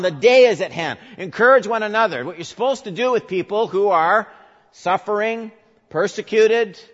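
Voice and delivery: high, loud, driving sermon voice; preaching at full force. Acted. Preacher driving Paul's words home to the church, loud and high. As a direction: hammer it home to the congregation.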